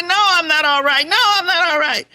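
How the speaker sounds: loud, high, fed-up repetition; exasperated outburst. Retold or imitated. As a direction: throw the words out in frustration. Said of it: "I'm gonna say".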